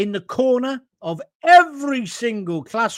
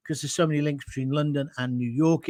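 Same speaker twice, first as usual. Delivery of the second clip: ordinary talk, nothing marked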